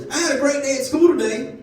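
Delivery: put-on high-pitched little girl voice; six-year-old niece. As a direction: voice a small child, high up. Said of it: "my niece is doing this weird thing".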